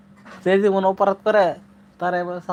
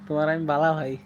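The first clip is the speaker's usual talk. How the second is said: Sylheti dialect; 2 semitones lower than usual. Shown as a demonstration. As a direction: teach the Sylheti phrase once more. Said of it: "এটা হলো সিলেটি ভাষা". (সিলেটি উচ্চারণে বালা বলা)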